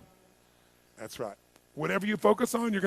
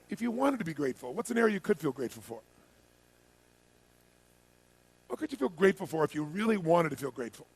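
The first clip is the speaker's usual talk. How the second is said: soft, lowered coaching voice; guided reflection; 6 semitones lower, 4 dB quieter than usual. Real, not acted. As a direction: lead them quietly into the feeling. Leads a gratitude exercise.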